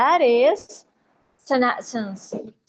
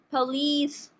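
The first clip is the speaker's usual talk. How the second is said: usual speaking voice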